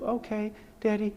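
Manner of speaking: lightly voiced little boy, high and soft; a soft hint of a child answering; young son. Son agreeing with Dad on the phone, lightly childlike. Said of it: "speak to [name]".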